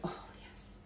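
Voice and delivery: whispering as the lid gives; hushed under-breath relief; whispered relief. Real, not acted. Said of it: "grippy things".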